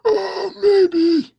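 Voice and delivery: put-on high, loud creaky old man. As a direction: play a croaky old quarterback. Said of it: "His old man voice".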